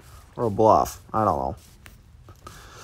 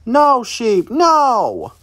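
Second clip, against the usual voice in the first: high, loud cry of "no"; urgent, high-pitched shout; alarmed shout; 12 semitones higher, 7 dB louder, much slower than usual. Real, not acted. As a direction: yell at the sheep to stop, high and loud. Calling out at sheep in game.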